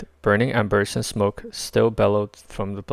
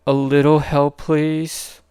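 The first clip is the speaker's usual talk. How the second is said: higher, louder ask mid-fight; strained plea; 5 semitones higher, 5 dB louder, slower than usual. A narrator's reading. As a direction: call for help while firing. Star-Lord.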